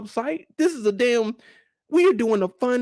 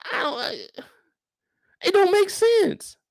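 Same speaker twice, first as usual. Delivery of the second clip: slow, much higher squeak; baffled; 12 semitones higher, slower than usual. Acted. Viewer baffled by the guest, slow and much higher. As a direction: stretch it out in disbelief.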